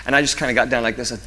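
ordinary delivery

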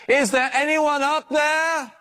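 slow, high yell into the sky; shouting upward. A narrator's reading. Dangling man yells up to whoever is above, slow high shout. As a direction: call out slowly, straining to be heard. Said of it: "he said".

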